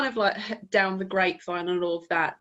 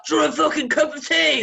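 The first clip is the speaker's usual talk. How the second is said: loud, raised-pitch British caricature; British person; 7 semitones higher, 6 dB louder than usual. Acted. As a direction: call out like a hospitable Brit. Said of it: "hear a fucking British person and be like".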